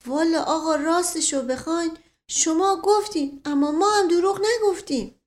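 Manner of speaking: simple servant, mildly aggrieved; defensive servant. Acted. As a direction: plead that you only answered. Mashghasem, insisting.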